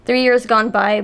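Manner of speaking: ordinary delivery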